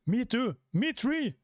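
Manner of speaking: put-on high piping children chiming in; bright high little-kid voices; eager kid voices. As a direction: squeak it out like small brave kids. Kids join the defiance.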